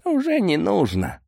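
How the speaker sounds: high-voiced, understated sadness; sad. A narrator's reading. Boris says the order needn't be cancelled, mildly sad. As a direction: start high, with a touch of sorrow. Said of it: "грустно ответил Борис".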